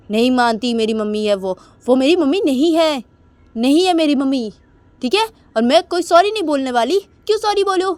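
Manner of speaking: loud, high defiant outburst; angry refusal. Acted. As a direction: shout down every demand to apologise. निशा गुस्से में इनकार करती है. (गुस्से में चिल्लाकर इनकार)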